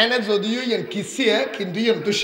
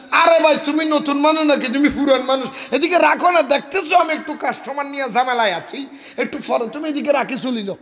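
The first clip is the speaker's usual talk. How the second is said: higher, louder hurried shopkeeper voice; busy shopkeeper; 6 semitones higher, 3 dB louder than usual. Acted. Shopkeeper brushing off the chhana seller, higher busy voice. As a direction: wave him off, you're busy with customers.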